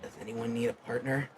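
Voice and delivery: quiet, scratchy question; scratchy, subdued voice. Acted. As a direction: ask it softly with a light scratch. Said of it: "a scratchy voice saying".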